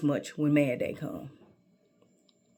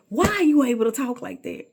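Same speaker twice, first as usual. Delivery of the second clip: higher, louder scolding question; teacher scolding; 7 semitones higher, 10 dB louder than usual. Retold or imitated. Teacher demanding an answer from a mouthy student, higher and louder. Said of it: "She says".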